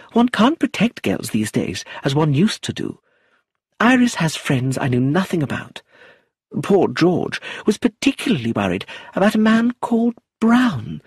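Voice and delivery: high, prim chattering lady's voice; a high, fussy society widow rattling on; fussy old lady. Acted. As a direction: play her as a prim, talkative elderly aunt. Lucilla chattering again.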